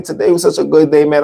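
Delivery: normal talking voice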